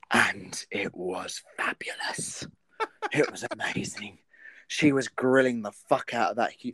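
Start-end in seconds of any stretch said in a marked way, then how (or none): 0.1-4.2 whispering. Real, not acted. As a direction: breathe it out in awe. Gushing about Nigella in hush.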